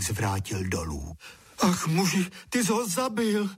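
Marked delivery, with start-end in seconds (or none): 1.6-3.5 high alarmed cry of a wife; voláníčko paní. A narrator's reading. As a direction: cry out to your husband, pitched high. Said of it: "zvolala paní". (zděšené zvolání vysokým hlasem)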